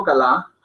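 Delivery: "galá" with a clearly voiced first A; two distinct syllables in "galá", stress last; mistaken full vowel. Shown as a demonstration. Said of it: "si yo digo".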